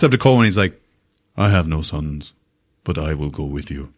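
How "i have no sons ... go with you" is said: put-on lower, softer young man putting on a deep voice; surprisingly deep voice. Young prisoner volunteering to Colwyn, low and grave. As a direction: drop it low, grave and quiet. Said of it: "He's got a much deeper voice than you would expect".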